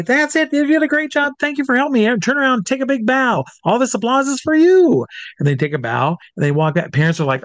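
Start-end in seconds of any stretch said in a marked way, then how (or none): 0.0-5.0 put-on very high, gushing thanks to a child; showman's praise. Showman sends little helper off to applause, excited praise. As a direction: lift the pitch way up and hold it for the applause. Thanking kid before audience.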